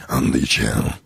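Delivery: low, growly monster bragging; Cookie Monster voice. Pretend. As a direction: Cookie Monster doing a gladiator. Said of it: "Cookie Monster doing Russell Crowe".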